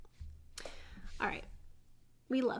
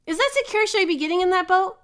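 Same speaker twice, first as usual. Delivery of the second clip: high-pitched mock worry; fearful doubt; 5 semitones higher, 5 dB louder than usual. Fearful person eyeing a boat, anxiously doubtful. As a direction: question it like an anxious passenger. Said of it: "people with fear motivation".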